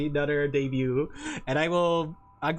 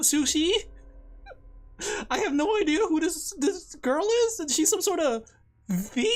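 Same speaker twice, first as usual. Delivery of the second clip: much higher, hesitant nerd voice; other nerd voice; 10 semitones higher than usual. Pretend. Nervous nerd pitching a game collab, voiced much higher. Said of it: "what was the other one".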